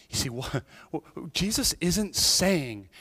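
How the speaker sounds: ordinary talk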